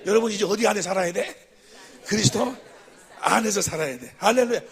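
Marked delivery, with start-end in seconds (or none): none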